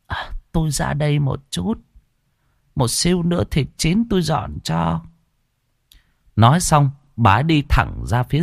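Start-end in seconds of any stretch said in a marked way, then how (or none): 0.1-5.0 lightly voiced old woman, slightly higher and softer; a touch of a sly granny slipping out; old woman's voice. Old woman excusing herself to the guests, lightly voiced old woman.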